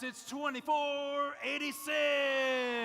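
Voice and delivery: slow, drawn-out hype call; fighting game announcer. Acted. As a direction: stretch every syllable for the arena. Team number drawn out for crowd.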